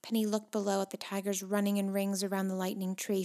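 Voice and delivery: plain reading voice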